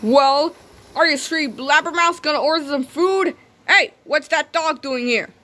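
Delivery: loud, high, brash waiter; pushy waiter voice. Acted. Waiter scolding the three diners about the dog. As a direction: demand it rudely. Gruff waiter confronts table.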